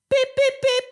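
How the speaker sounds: three very high, short beeps; imitated alarm chirp. Imitating the mystery chirping at home, very high beeps. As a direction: squeak out three identical electronic beeps. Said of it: "something's just chirping".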